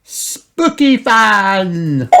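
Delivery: put-on high, loud, very drawn-out child question; Peppa asking. A narrator's reading. Peppa asks Granny, high drawn-out voice. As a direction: stretch it out as a little girl.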